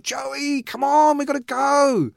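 high, drawn-out impatient call; calling upstairs. Acted. Bowie calls his son down the stairs, impatient shout. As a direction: call up to someone dawdling upstairs.

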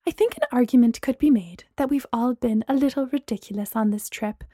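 ordinary delivery

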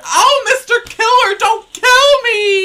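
shrill slow squealing plea; begging for my life. Retold or imitated. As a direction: wail it like a hammy scream queen. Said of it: "she's like".

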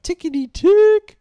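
high, loud sing-song call; playful squeal. Pretend.

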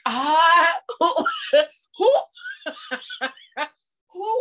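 high, laughing "who?"; laughing mock confusion. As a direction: look around the room, laughing. Acting confused, looking around room.

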